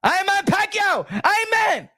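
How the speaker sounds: high, shouted Filipino-boxer impression; Pacquiao impression. Boasting as Pacquiao before the fight, high shout. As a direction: puff up as the champ. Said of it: "I be Mario Barrios".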